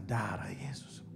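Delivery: breathless, slow whispered aside; low slow horrified whisper; shocked whisper. Real, not acted. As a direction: whisper it under your breath, stunned. Shocked aside after manager's news.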